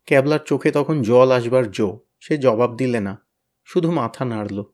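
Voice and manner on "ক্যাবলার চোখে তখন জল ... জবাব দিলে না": usual speaking voice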